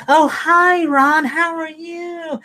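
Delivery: high, syrupy fake-friendly greeting; mock the phony nice guy, way up high; fake sweet voice. Mocking a two-faced coworker greeting Ron, sugary and high.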